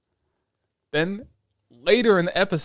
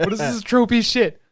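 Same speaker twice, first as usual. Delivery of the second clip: higher, louder stunned exclaim; incredulous outburst; 9 semitones higher, 7 dB louder than usual. Retold or imitated. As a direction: blurt it out in disbelief at the cliché. Disbelief at anime trope.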